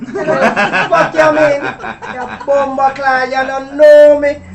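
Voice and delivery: hammed-up Jamaican accent, slowing on the last line; mock Jamaican accent. Laughing Jamaican accent thrown back at the co-host who teased him. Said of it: "what's with the accent?".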